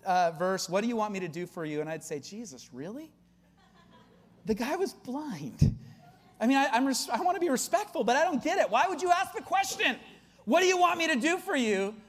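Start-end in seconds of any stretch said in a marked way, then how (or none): 2.2-3.1 soft, deadpan disbelief; hushed incredulous question; skeptical kid. Acted. Kid doubting the verse. 4.5-10.0 high-pitched incredulity; exasperated, voice climbing high; baffled protest. Acted. Acting his baffled younger self. 10.4-11.9 high, exasperated echo of the question; baffled, pitched-up repetition; incredulous quoting. Acted. Mocking the puzzling question.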